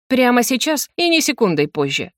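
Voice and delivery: plain reading voice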